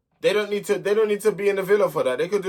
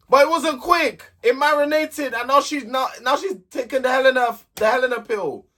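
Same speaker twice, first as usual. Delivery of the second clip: exasperated pitch rise; 8 semitones higher than usual. Real, not acted. Exasperated rant about Tony.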